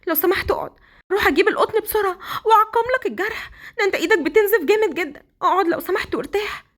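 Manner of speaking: high, panicked wife fussing over his wound; high anxious wife, near tears; frightened wife. Acted. (زوجة مفزوعة على إيد جوزها بصوت عالي)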